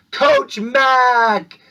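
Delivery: excited reveal. Acted. Announcer revealing the staff birthday, loud excited call-out. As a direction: shout the name like a big reveal.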